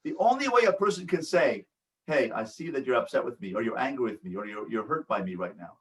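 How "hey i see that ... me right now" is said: low and soft, trailing softer; gentle acknowledgement. Acted. Person to someone they hurt, gently acknowledging. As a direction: quietly own that they are upset with you.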